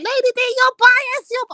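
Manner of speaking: high, mocking imitation of a complainer; mocking whiny voice. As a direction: mimic him in a high sneering whine. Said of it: "So Vader's like".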